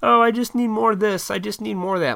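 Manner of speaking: high, loud imitation of complainers; mock complaining. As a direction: complain greedily, hamming it up. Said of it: "what people were complaining about".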